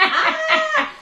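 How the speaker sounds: high, loud laughing mock scream; mock pain scream; retold scream. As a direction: shriek it again, half laughing. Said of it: "I was literally screaming".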